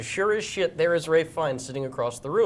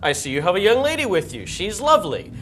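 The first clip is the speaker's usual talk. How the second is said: gracious star; 6 semitones higher than usual. Pretend. Imagined star greets his friend and date, gracious. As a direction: lightly play a star delighted to see a friend. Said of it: "And he would say".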